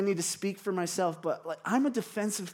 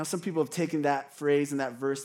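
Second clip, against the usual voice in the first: normal talking voice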